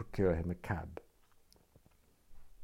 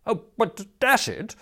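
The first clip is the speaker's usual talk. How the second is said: high, louder spluttering objection; exasperated protest; 14 semitones higher, 6 dB louder than usual. A narrator's reading. Youth interrupts Smith's raptures, exasperated.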